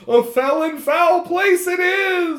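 high, loud, slow ghost; a spooked little man calling it foul; nervous ghost. Acted. Ghost warning the player, high, loud and slow. Ghost [name] voiced.

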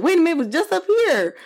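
high, confused voice over the bottle; retelling her drunk self staring at the Azul bottle; mock drunk puzzlement. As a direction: play tipsy bafflement. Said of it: "And I was like".

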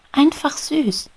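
high, loud delighted gush. Acted. Little bee Maya gushes over the beetle's feelers, high and delighted.

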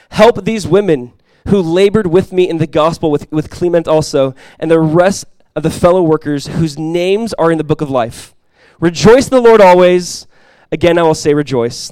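loud, emphatic Bible reading from the pulpit; scripture reading. Acted. Pastor reading an epistle aloud to the church, emphatic scripture reading.

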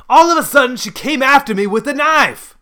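very high, loud, outraged protest; hollering. A narrator's reading. Grandfather defending himself to his son, hollering. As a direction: holler the accusation at the top of your range. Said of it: "Grandpa hollered".

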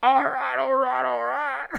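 slow, drawled all right all right; Matthew McConaughey impression. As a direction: drawl it like the actor. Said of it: "That was Matthew McConaughey as Bane".

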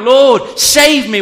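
high, urgent acted plea; crying out. Preacher reading Peter's plea, crying out. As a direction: cry it out as if going under. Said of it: "cried out".

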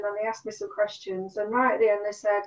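ordinary talk

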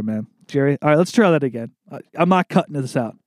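ordinary talk

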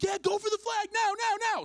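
high-pitched, loud captain's yell; mock battlefield shouting; frantic shouted order. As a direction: urge a teammate out under fire. Captain yells order under fire.

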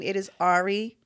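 "Ari" said AR-ee, low; AR-ee pronunciation. Shown as a demonstration. Host settles the listener's question, AR-ee pronunciation of "Ari". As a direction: open "ah" at the start of "Ari". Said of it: "Then it's".

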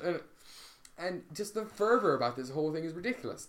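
ordinary delivery

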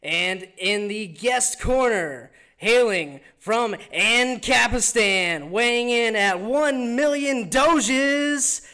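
slow, booming ring announcer intro; grand entrance announcement. Pretend. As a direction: announce him like a title fight. Boxing-style guest introduction.

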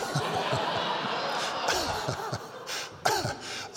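airy high-pitched laugh. Real, not acted. Pastor laughing at his own wedding story, breathy and high.

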